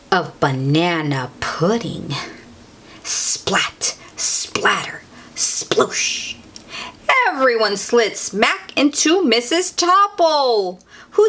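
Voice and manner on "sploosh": plain reading voice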